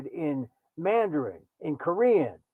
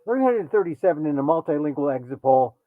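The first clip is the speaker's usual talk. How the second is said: normal talking voice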